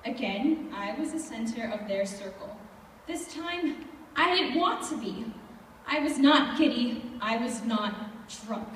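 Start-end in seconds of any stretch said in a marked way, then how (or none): none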